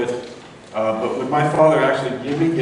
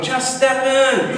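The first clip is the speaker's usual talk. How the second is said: much higher, slow coaxing call; rescuer's call; 11 semitones higher, 3 dB louder, much slower than usual. Retold or imitated. Helicopter crew calling down to the man on the roof, high slow plea. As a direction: plead with him from the helicopter. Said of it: "they say to him".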